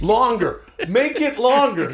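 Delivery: high mock urging. Urging to stretch the podcast.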